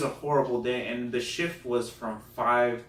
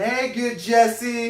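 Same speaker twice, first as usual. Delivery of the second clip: loud, high, drawn-out party cheer; crowd greeting; 12 semitones higher, 10 dB louder, much slower than usual. Retold or imitated. Drunk party greeting good Jesse, loud mock cheer. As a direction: holler it slowly like a whole crowd. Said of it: "And everybody's like".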